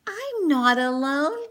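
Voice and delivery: ordinary delivery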